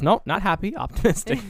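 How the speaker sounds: loud, high-pitched contradiction; mock-contrary retort; argumentative. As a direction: correct him as if arguing every word. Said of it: "He's just arguing with him about everything".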